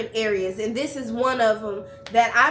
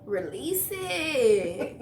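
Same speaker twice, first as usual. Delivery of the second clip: very slow, stretched-out words with swooping pitch; long, drawn-out playful coaxing; soothing urging; much slower than usual. Acted. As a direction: playfully draw out each word like letting tension go. Host playfully urging stress relief.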